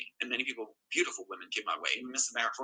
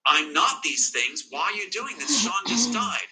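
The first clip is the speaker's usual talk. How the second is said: pleading; 7 semitones higher, slower than usual. Retold or imitated. Retelling his plea to sister.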